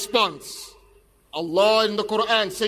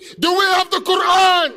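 raised, higher call to the crowd; rallying shout; 6 semitones higher, 4 dB louder than usual. Real, not acted. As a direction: work the crowd with a shouted question.

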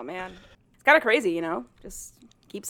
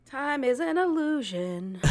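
slow, high, drawn-out pronouncement; mock-mystical; 5 semitones higher, slower than usual. Host dodging her age, slow and mock-mystical. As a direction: stretch it out like a fake sage.

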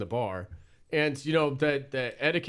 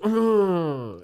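louder, higher drawn-out mock groan; annoyed groan; 5 semitones higher, 5 dB louder than usual. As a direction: groan like a customer getting mad. Imitates annoyed bar customer's noise.

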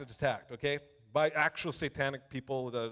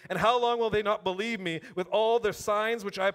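raised, ringing Bible reading to the room; scripture reading; 4 semitones higher, 4 dB louder than usual. Acted. Preacher reading the spies' report aloud to the church, proclaiming. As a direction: proclaim the verse to the congregation.

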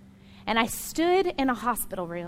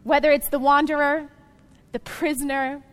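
preaching loudly; 3 semitones higher, 5 dB louder than usual. Acted. Preacher addresses all listeners.

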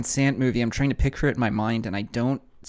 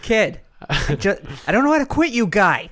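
put-on much higher, louder Boston accent; over-the-top Boston accent; 9 semitones higher, 6 dB louder than usual. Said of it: "in a Boston accent?".

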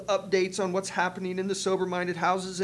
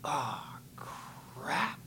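whispered under the breath; a stunned whisper to himself; whispered dismay; 4 semitones lower, 10 dB quieter than usual. Retold or imitated. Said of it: "I'm sitting there going".

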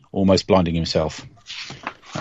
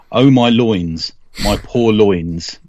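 dramatic reading; much slower than usual. Acted. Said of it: "a dramatic reading of".

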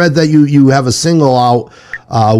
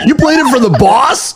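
high, incredulous laughing question; comic shocked question, much higher; mock astonishment; 9 semitones higher than usual. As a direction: blurt it out in amused disbelief. Host acts out the astonished reaction.

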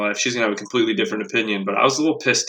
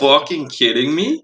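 incredulous, higher-pitched retort rising at the end; retold outrage; 4 semitones higher than usual. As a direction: act out disbelief at the cashier.

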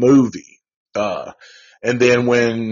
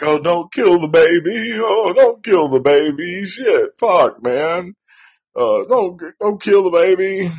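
higher-pitched mock pleading; Peter; 6 semitones higher than usual. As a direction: imitate a man pleading on the phone. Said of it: "and Peter's like".

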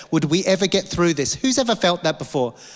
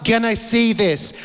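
despairing; 5 semitones higher than usual. Retold or imitated. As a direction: voice lifted high, slowed, hopeless. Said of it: "it's like".

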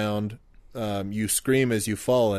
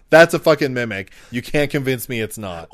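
exclaiming; 2 semitones higher, 7 dB louder than usual. Retold or imitated. Imagined paranoid player reaction.

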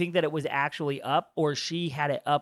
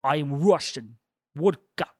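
mock Russian, the v in Vodka said as a w; bad Russian accent; slower than usual. Friend parodying the character's whole accent: mock Russian. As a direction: plonk each word down like a cartoon Russian. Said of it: "you hear this girl basically go".

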